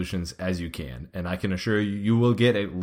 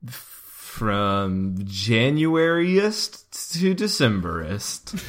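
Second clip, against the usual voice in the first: slow; much slower than usual. Acted. Comic word coinage.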